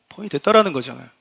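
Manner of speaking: ordinary talk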